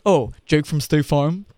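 imitating the ad line, slow; State Farm ad voice. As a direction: lightly mimic the commercial's question. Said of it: "with that voice and everything?".

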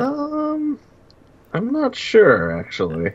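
high, very slow, drawn-out doubt; teasing doubt. Pretend. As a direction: stretch it out like you don't believe him. Teasing doubt about his attendance.

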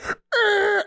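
high-pitched skidding car noise; imitated tire screech. Storyteller recreating her car cutting across lanes, mimicked screech. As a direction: screech like swerving tires.

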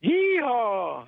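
big cowboy cry, tailing off; rodeo whoop. Pretend. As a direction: holler it back to the host. Caller echoes the rodeo catchphrase.

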